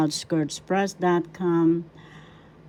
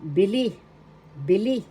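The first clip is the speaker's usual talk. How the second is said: "bili" with the final "li" stressed and rising; stress on second syllable; 2 semitones higher than usual. Shown as a demonstration. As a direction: teach "bili" as bi-LI, twice. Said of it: "The accent is in the second syllable".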